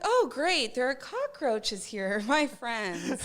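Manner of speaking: loud, high mock-impressed exclamation; mock delight. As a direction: gush sarcastically, drawing it out. Mock delight at trashy apartment.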